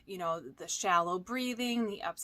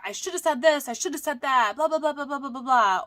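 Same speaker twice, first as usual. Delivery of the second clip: higher, louder, spiralling anxious inner voice; anxious racing thoughts; 5 semitones higher, 8 dB louder, faster than usual. Retold or imitated. Coach acting out a client's anxious spiral, panicky and loud. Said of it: "maybe your anxious thoughts are".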